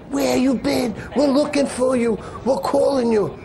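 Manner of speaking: high, worked-up retelling of a searcher's questions; urgent calling out. Retold or imitated. Chauffeur finding his missing colleague, high and urgent. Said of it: "He starts going to me".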